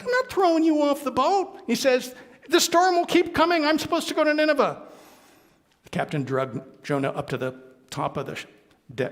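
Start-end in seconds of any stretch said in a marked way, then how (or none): 0.0-4.8 high, agitated argument. Acted. Captain and Jonah arguing.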